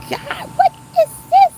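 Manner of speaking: very high, loud and slow cry of alarm; high frightened cry at a creature in the water; alarmed shriek. Real, not acted. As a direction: shriek at the alligator. Alarmed at alligator.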